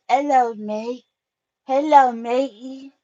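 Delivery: high, loud mock British "mate" greeting; British accent. Shown as a demonstration. To her man while role playing, a hammed-up British accent. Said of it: "You gotta switch up your voice".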